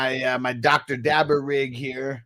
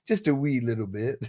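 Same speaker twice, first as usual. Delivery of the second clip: put-on British accent; 5 dB quieter than usual. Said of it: "I didn't know you speak British".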